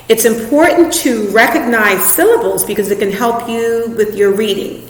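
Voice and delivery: ordinary delivery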